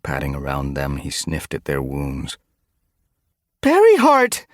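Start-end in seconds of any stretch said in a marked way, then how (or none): 3.6-4.5 much higher, louder cry of a name. A narrator's reading. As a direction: call out in shock across the camp. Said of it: "Sunbeam's cry made Frostpaw jump".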